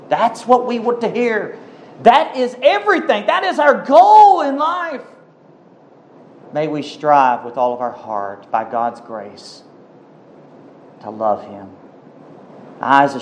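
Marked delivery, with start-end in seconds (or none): 0.1-5.0 fervent climax. Real, not acted. Preacher urging the church, rising higher and louder. 6.5-11.7 gentle close. Real, not acted. Closing tender exhortation.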